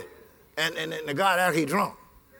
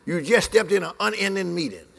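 normal talking voice

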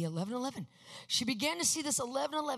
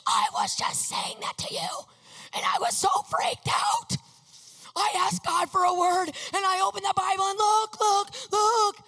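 much higher and louder, breathless and rushed; freaked-out excitement; 9 semitones higher, 6 dB louder than usual. Retold or imitated. Showing Ken the verse, a freaked-out high loud rush. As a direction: thrust the Bible at her, beside yourself.

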